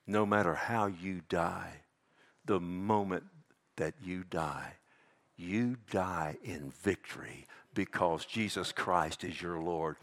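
low, soft, slow assurance; solemn preaching. Real, not acted. Preacher assures believers of victory in death, hushed and solemn. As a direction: drop down and let each phrase settle gravely.

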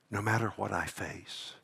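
almost breathless, very soft. Real, not acted. Pastor repeating his assurance, very soft. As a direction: pull it right down to a hush.

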